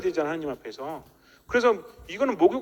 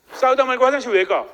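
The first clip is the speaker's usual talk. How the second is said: louder, higher irritated comeback; annoyed spouse retort; 4 semitones higher, 6 dB louder than usual. Retold or imitated. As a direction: snap back like an annoyed spouse mid-fight. Spouse's angry retort in quarrel. (싸우는 중에 화장실 간다는 배우자의 짜증 섞인 반문)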